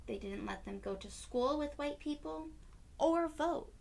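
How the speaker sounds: ordinary delivery, nothing marked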